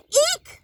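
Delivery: a sudden, very high, loud shriek of alarm; shocked shriek. A narrator's reading. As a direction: shriek at the sight of the dangling feet. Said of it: "she cried".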